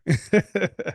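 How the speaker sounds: high, loud bashful laugh. Real, not acted. Guest laughing about his Southern drawl, in a high loud laugh. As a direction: burst into a laugh.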